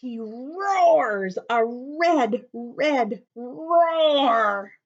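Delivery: storyteller roaring the words. Acted. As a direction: let the red roar rumble out. Roar dramatized.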